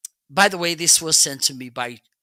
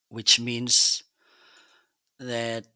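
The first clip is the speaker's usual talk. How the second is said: ordinary talk, nothing marked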